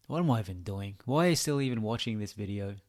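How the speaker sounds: usual speaking voice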